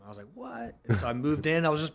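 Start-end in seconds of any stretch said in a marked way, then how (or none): 0.3-0.8 high, soft 'what?'; mock-amazed high reaction; retold disbelief. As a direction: squeak out mild astonishment, much higher and quieter. Retold reaction to room offer.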